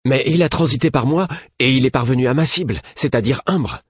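high, loud triumphant exclamation; exultant. A narrator's reading. Prince claiming success to his teacher, exultant. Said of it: "s'exclama Devoir, exultant".

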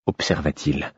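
ordinary delivery

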